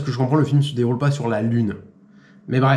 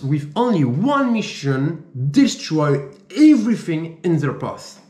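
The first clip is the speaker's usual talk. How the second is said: French speaker's showy English accent, drawn out; English accent attempt; 3 semitones higher, slower than usual. Shown as a demonstration. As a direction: read it slowly in your best effortful English accent. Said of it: "notez mon accent en commentaire". (accent anglais appliqué, lecture lente)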